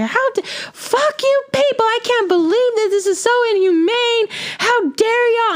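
high shrill imitated screech at the staff; furious mother imitated. Mother yelling at dental staff, imitated high screech. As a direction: play the outraged mom shrieking at reception. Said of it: "And she's like".

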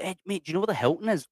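quick, high-pitched friendly question; acted lost visitor. Traveller asking a local for hotel directions, acted quick friendly query. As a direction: play someone hailing a local for help, fast and high. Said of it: "you'll be like".

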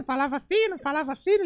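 tiny high schoolboy squeak; thin squeaky voice. Acted. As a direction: pitch it way up like a squeaky little boy. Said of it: "O molequinho na escola falava fino".

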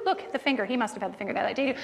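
ordinary talk

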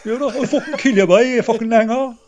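high, loud mock Cork threat; Cork accent. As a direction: play up a Cork slagging. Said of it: "don't fucking start now with the Cork shit".

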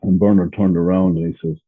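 ordinary talk, nothing marked

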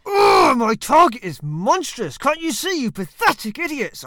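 loud, slow, scornful roar at the crowd; scornful ranting. Acted. Roman satirist scorning society, yelling in contempt at idiots. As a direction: bellow your contempt like a furious Roman satirist.

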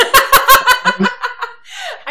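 big high burst of laughter; loud high laugh. Real, not acted. After her baked bean rant, a loud high laugh.

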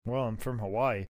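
low, quiet admission; understated Crush. Acted. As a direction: say it small and deep. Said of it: "Crush is like".

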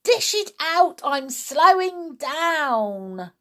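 high, loud, drawn out at the end; demanding yell. A narrator's reading. Grandma wants another dose before she slows, demanding yell. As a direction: order it loudly, stretching the last words. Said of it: "she yelled".

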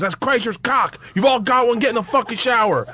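high-pitched imitation of an exasperated coach; mock coach announcing to the whole team; fed-up coach. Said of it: "he pulls my pants down".

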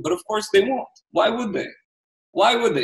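sarcastic jab, pitch way up; real exasperation, high sarcastic repeat; emotional sarcasm. Real, not acted. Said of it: "Sorry I'm emotional about this".

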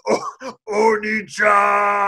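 put-on slow, drawn-out shouted anime-girl cry; hammed-up call to her big brother; mock anime shout. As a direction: wail it like a monster girl in an anime. Sister turns to brother.

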